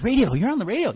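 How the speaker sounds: high, loud mock surprise; astonished echo question; incredulous client. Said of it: "They're like".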